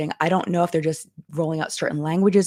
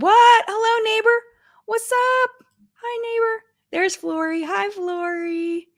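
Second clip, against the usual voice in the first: much higher, delighted squeal; squealy excited greeting; 4 dB louder, slower than usual. Real, not acted. Streamer squealing hellos to viewers in chat.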